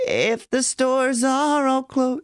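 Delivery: creaky door onset, sung high and slow; vocal fry. Shown as a demonstration. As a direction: start the line with a creak. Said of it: "this creaky door".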